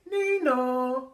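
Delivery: high siren nee-naw; siren noise. A narrator's reading. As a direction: imitate a police siren.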